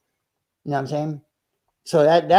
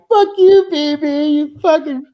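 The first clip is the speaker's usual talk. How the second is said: loud, much higher, hammed-up drunk aggression; mock belligerent yelling; 8 semitones higher, 5 dB louder, slower than usual. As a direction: yell it like a fake tough drunk, half joking. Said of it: "I'm like, like".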